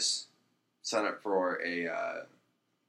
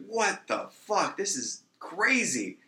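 high, slow, drawn-out disbelief; acted astonishment; 9 semitones higher, much slower than usual. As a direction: stretch it out like stunned golfers. Golfers astonished at the rifle.

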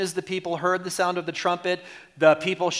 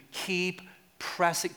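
ordinary delivery

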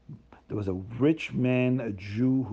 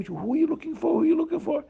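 curious townsfolk; much faster than usual. Acted. Townspeople ask the rich man.

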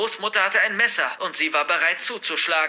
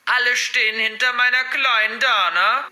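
high, loud mocking granny voice; gleefully sneering, pitched well up; cackling grandmother; 8 semitones higher, 6 dB louder than usual. A narrator's reading. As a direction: taunt like a wicked old woman. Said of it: "gackerte sie".

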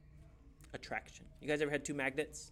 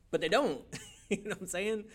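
ordinary talk, nothing marked

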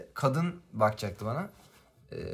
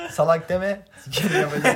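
louder, higher, laughing mock fury; hammed-up outrage at the teacher; mock angry retort; 7 semitones higher, 10 dB louder than usual. Recalling a burned hand, mock-cursing the teacher loudly.

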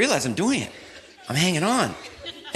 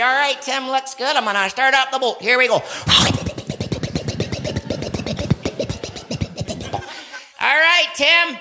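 gleeful boat driver; 10 semitones higher than usual. Retold or imitated. Friend starting the boat.

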